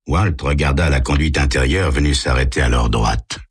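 ordinary talk

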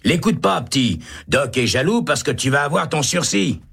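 high, eager inmate voice; reassuring prisoner. Acted. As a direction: talk the kid out of his fear. Holt reassuring Baca. (voix haute de détenu rassurant)

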